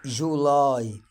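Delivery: جولاي with a clear ai at the end; July said loud and clear for students to copy; modeling y as ai. Shown as a demonstration. Teacher models a word for students to repeat, clear. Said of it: "تحاول تنطقها وتشوف أنت نطقتها صح".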